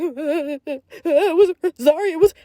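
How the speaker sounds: high, choked mock whimpering. Sister mimicking her scared brother on the floor, whimpering. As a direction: imitate a little brother's tearful whimper. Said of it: "like, whimpering".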